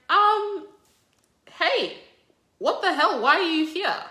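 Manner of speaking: startled to see him arrive; surprised God. Retold or imitated.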